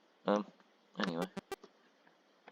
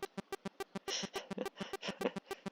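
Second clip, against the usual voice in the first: high giggle; 7 dB quieter than usual. Real, not acted. Laughs at own joke.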